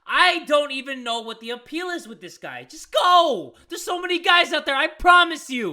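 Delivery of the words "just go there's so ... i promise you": loud and pleading, voice up high; shouting. Real, not acted. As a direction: yell it at her to leave.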